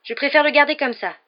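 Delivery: high, loud objection; pleading protest pitched well up. A narrator's reading. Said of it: "protesta Harry".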